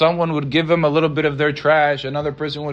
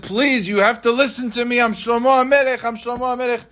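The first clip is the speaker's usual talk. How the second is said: pleading; 7 semitones higher than usual. Acted. Beggar king pleading with woman.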